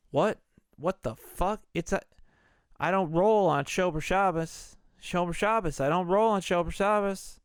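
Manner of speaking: retold outburst of indignation; mock indignant outrage. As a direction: act out offended disbelief, voice pushed up. Acted outrage at Saturday match.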